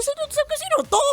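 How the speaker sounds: very high, curious who is this; puzzled. Retold or imitated. Scrolling past an unknown influencer, high puzzled question. Retold reaction to feed.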